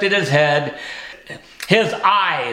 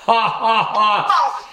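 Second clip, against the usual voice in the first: higher teasing ha ha ha; mocking laugh; 4 semitones higher, much slower than usual. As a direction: laugh at the cat to tease him.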